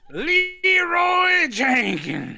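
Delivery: high, very slow drawn-out shout; slowed-down war cry; slow-motion battle cry. Pretend.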